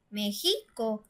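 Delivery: México mis-stressed as "mexíco", weight on "xi"; stress on middle syllable. Shown as a demonstration. Teacher trying México with the second-to-last syllable stressed, "mexíco". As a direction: lean on the "xi" in México. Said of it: "si está en la penúltima sílaba".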